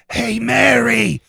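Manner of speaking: loud high shouted whisper; old people whisper. Retold or imitated. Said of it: "old people whisper like".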